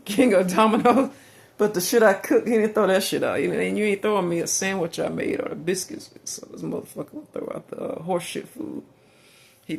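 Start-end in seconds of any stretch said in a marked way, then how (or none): 0.0-6.1 louder, heated indignant rant. Real, not acted. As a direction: push it out in outrage. Ranting about his ex.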